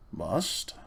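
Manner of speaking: whispered, questioning sneer; mocking whisper. A narrator's reading. Uncle Andrew mocks Diggory's demand, scornful whisper. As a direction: repeat the word back with quiet scorn.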